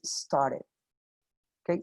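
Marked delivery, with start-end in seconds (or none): 0.0-0.7 "started" with a soft flapped T, American style; "started" said as star-ded, American flap; American pronunciation. Shown as a demonstration. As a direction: relax the T of "started" into a quick D. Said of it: "Una pronunciación más americana".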